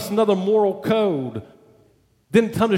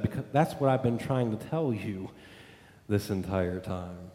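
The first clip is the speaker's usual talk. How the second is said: quiet confession; 9 semitones lower, 9 dB quieter than usual. Retold or imitated. Son comes clean to father.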